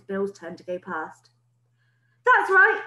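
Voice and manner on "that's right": high, loud, cheery encouragement; encouraging call. Acted. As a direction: cheer the boy along. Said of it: "called out encouragingly".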